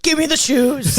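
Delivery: loud, high mock demand; hammed-up forceful bird voice; demanding beefcake bird. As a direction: bark the demand like a pumped-up bird.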